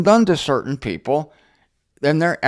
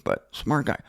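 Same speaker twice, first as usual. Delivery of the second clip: normal talking voice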